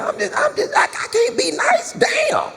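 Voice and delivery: very high mock yell, half sung; mock dad outburst. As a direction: belt it out high like dad losing it. Dad yelling at school office.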